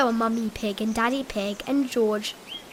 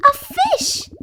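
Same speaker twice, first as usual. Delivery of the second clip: very high excited squeal; Peppa delighted; 4 dB louder than usual. Acted. Peppa seeing a fish in the tide pool, high squeal. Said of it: "says Peppa".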